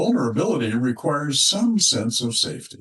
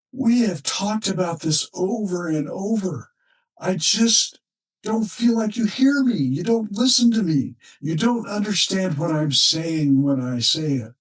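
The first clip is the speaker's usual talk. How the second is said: higher-pitched pleading wife, pressing her point; frustrated wife; 7 semitones higher than usual. Retold or imitated. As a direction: voice the wife's exasperated plea, higher up. Wife pleads, feeling unheard.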